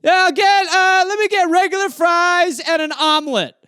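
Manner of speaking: high put-on customer voice ordering fries; high, put-on voice placing a fast-food order; drive-thru customer. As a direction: play a dopey customer ordering at the window.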